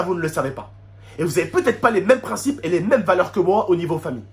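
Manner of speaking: ordinary delivery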